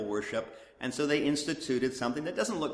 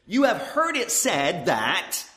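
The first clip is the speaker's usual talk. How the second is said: King James cadence; 3 semitones higher, 6 dB louder, slower than usual. Retold or imitated. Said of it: "King James there".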